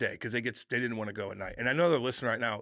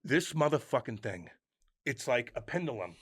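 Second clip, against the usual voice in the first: ordinary talk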